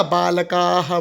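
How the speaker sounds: बालकाः slow, long "kaa" then "ha"; बालकाः as "baa-la-kaaa-ha"; long-vowel visarga. Shown as a demonstration. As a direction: draw out the end of बालकाः as "kaa-ha". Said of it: "बोलेंगे हम".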